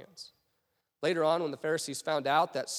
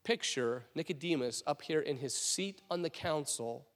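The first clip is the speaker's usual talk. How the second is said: usual speaking voice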